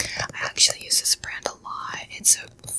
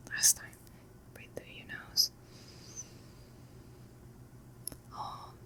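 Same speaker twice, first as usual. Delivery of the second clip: guided breathing; 3 semitones lower, 4 dB quieter than usual. Acted. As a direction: coax them through each breath, unhurried.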